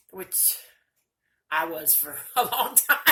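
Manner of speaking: breaking into a loud laugh; laughing admission. Real, not acted. Speaker admits she was bitter a long time, laughing. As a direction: say it through a burst of laughter.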